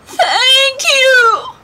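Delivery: very high, loud squeaking thanks; tiny mice squealing their thanks to George; squeaky mice. Acted. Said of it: "they squeaked".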